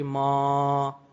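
ما drawn out on one steady pitch; long flat-pitched ما, like a held note; level tone. Shown as a demonstration. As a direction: hold ما long and even, without bending it. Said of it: "یه بار یکسان مثلاً بکش".

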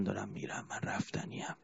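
hushed whisper of farewell. Acted. Preacher voicing the Imam's secret goodbye in a whisper. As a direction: breathe it out, barely voiced.

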